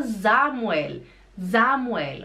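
Samuel opening on a buzzed Z; German pronunciation. Shown as a demonstration. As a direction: say Samuel as ZA-mu-el, stressing ZA. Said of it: "o S acompanhado por uma vogal". (Samuel em alemão, começando com som de Z)

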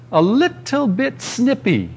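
slow, drawn-out "little bit snippy"; measured comic emphasis on each word; teasing understatement. Real, not acted. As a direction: stretch out "little bit" knowingly.

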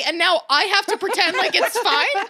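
high-pitched, indignant mock rant; acted indignation. Daughter confronts mom over regifted present, acted indignation. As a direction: protest in disbelief, playing up the outrage.

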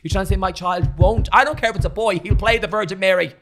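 mock outrage. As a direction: rant like an outraged parent, half joking. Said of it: "I'm getting so agitated".

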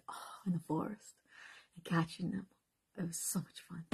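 soft wistful whisper. Real, not acted. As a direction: hush it like a fond memory. Whispered childhood memory.

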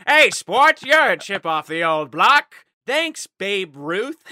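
mock old-timey ballplayer. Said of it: "And you're like".

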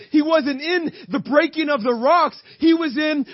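high, pressing sermon delivery; impassioned preaching. Real, not acted. Preacher to church on feeling defeated in faith, impassioned. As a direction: drive it home to the congregation.

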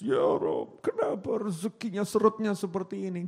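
half-whispered mock lament to God; breathy fake complaint about money, lightly voiced; mock weepy prayer. Wife complaining to God about money, mock weepy.

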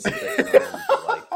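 words broken up by laughter; can't hold it together, laughing mid-line. Real, not acted. Cracking up while quoting the clueless actor.

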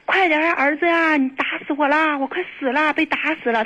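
high-pitched mock plea for rescue; retold wailing outcry. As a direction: imitate a hysterical call to a son, not truly shouted. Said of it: "他就咆哮".

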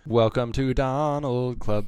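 slow quacky duck voice; Donald Duck voice. Pretend. Hosting in Donald voice.

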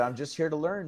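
mildly subdued, explaining yourself; meek reply. Retold or imitated. Tripper answering the minotaur, meek and quiet.

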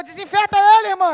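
loud, high call across the room; joking shout. Retold or imitated. Another woman yelling a joke across the church, loud and high. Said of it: "a outra de cá gritou".

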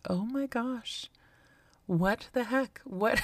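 lightly acted amazement at a petty remark; stunned disbelief. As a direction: let the inner thought drop open in shock. Retold inner shock at mom.

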